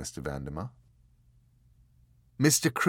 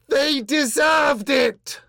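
much higher, louder, slow uncontrolled shriek; crazy scream; 11 dB louder, slower than usual. Acted. The angel screaming at its captives in fury. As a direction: scream it out, utterly certain and unhinged. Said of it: "it screamed at them".

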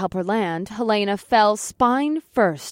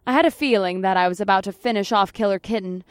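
plain reading voice

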